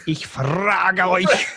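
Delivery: slow, loud, shouted mock German tirade. Joking about German accents, slow loud mock German shout. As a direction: bark it slowly like a parody dictator. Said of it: "Like, like".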